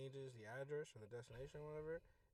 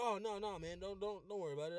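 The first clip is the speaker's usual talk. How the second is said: flustered passenger; 6 semitones higher, 7 dB louder than usual. Retold or imitated. Retold passenger hurriedly reassuring driver.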